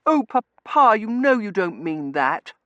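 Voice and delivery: very high, loud whine; high-pitched girlish reproach; reproachful daughter. A narrator's reading. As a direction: protest to Papa, drawing out the words.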